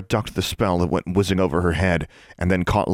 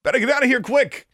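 called out. A narrator's reading. Larry calls back while fleeing, urgent. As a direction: call it back as you flee. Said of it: "he called over his shoulder".

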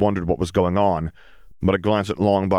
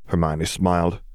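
ordinary delivery, nothing marked

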